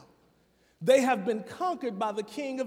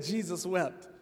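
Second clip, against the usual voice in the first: soft, lower, throwaway "Jesus wept."; quiet mock recital; 5 semitones lower, 4 dB quieter than usual. Preacher replaying the lazy verse as the punchline, soft and deadpan. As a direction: drop it quietly, the flat punchline. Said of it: "so they said".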